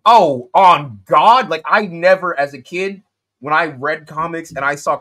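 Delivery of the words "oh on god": slow, loud amazed exclamation; excited shout. Retold or imitated. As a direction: blurt it out in disbelief. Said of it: "I was like".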